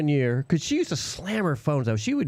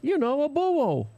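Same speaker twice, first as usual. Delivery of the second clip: put-on slow, higher Brooklyn accent on buo; Brooklyn accent imitation; 9 semitones higher, slower than usual. Quoting a coworker explaining Brooklyn borough. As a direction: mimic her patiently saying borough as buo. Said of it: "that's how she talked".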